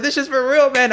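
high, loud, half-laughing alarm; panicked disbelief. Retold or imitated. Tased man realising it is real, panicked disbelief. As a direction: blurt it out in reenacted panic.

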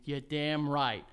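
ordinary delivery

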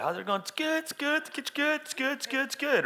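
very high chirpy small-talk voice; fake cheery greeting. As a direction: squeak it out as mindless, repeated pleasantry. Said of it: "we walk up like".

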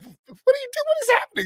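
very high, incredulous mock squeal; mock bafflement. Host reacting to coach's fake accent, mock bafflement. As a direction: shoot the pitch way up in disbelief.

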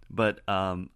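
ordinary delivery

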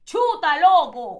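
loud, high-pitched Guayaquil exclamation; Guayaquil accent. Retold or imitated. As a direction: imitate a guayaca, shouted high. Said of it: "es la que tiene más acento".

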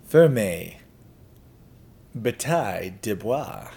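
slow, careful try at a French accent; French accent attempt. Shown as a demonstration. Sponsor asked for their name in French, slow careful French accent. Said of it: "they wanted me to say their name in French".

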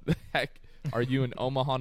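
normal talking voice

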